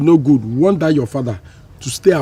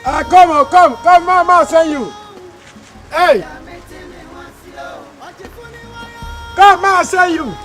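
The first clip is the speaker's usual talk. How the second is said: high, raised call to someone away; high-pitched hollering over; 10 semitones higher than usual. Acted. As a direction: summon them from a distance, pitch right up. Calling someone over for an errand.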